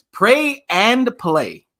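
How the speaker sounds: "pray" and "play" each stretched and punched; slow right down on "pray and play", weighting both; emphatic pairing. Real, not acted. Stresses the two words for effect.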